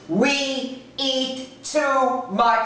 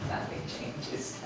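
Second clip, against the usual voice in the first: nothing changes whispered slowly; whispered punchline; 6 semitones lower, 15 dB quieter, slower than usual. Shown as a demonstration. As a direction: whisper it, slow and low. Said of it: "if nothing changes".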